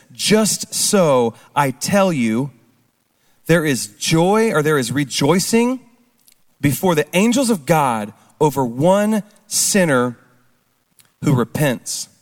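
slow, deliberate reading of the verse; measured scripture reading with pauses. Acted. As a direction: read the verse slowly, letting each phrase land. Reads scripture slowly.

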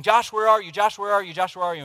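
loud, high, insistent calling; mock-frustrated wife hollering; imitated repeated yelling. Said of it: "she just keeps asking".

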